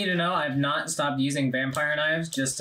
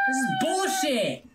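high exasperated yell; shouting at the game; frustrated shout; 11 semitones higher than usual. Real, not acted. Boss keeps duplicating.

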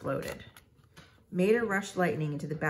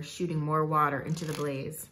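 usual speaking voice

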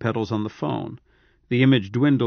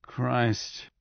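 much softer, flat toneless curse; 7 dB quieter than usual. Acted. Cyborg muttering to himself on the line, soft and toneless. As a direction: drop it to almost nothing, no emotion. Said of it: "softer but equally toneless".

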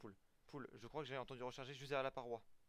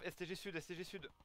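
ordinary talk, nothing marked

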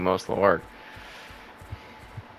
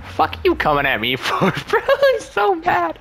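mock mad. Mocking opponent after a hit.

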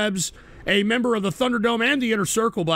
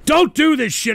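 annoyed scolding; 5 semitones higher, 6 dB louder than usual. Real, not acted. Scolding donor.

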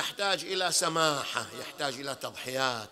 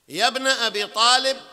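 loud calling out; 6 semitones higher, 12 dB louder than usual. Shown as a demonstration. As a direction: call out يا ابن أبي طالب loud and high, letter by letter. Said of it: "حرف حرف حتى نختم". (نداء عالٍ حرفًا حرفًا)

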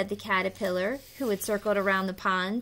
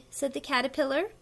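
ordinary delivery

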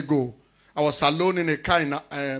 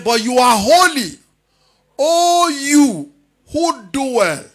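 slow, raised, ringing scripture proclamation; proclamatory preaching; 6 semitones higher, 9 dB louder, much slower than usual. Real, not acted. Preacher reads the translation to his congregation, proclamatory preaching. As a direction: declaim it in big, drawn-out phrases.

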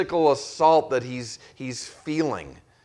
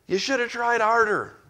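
exasperated boss; 9 semitones higher than usual. Retold or imitated. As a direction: bark the question like a boss who won't listen. Boss blaming employee, acted exchange.

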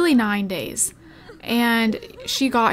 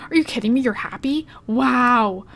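usual speaking voice

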